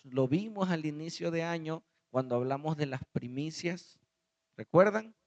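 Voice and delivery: usual speaking voice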